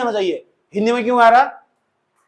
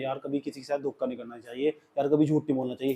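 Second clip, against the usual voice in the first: much softer, lower, sincere plea; heartfelt advice; 7 semitones lower, 12 dB quieter than usual. Shown as a demonstration. Teacher showing students the feeling in Hindi, soft and sincere. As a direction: say it like you truly mean it. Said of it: "इसमें तो feeling है".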